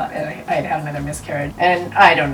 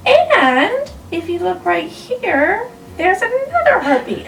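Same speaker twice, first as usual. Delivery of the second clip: doctor's reveal; 13 semitones higher, 4 dB louder, slower than usual. Retold or imitated. Doctor reveals second heartbeat.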